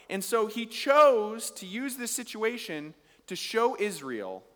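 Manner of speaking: preacher rousing the congregation about God's greatness; preaching at full force. Acted. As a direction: rise to a rousing climax.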